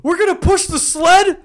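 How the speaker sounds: high, slow, laughing coach impression; mock coach. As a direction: ham up a bossy coach through laughter. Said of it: "his football coach was like".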